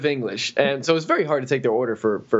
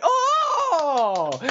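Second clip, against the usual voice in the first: high excited oh. Retold or imitated. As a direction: shout a high oh. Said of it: "they all went".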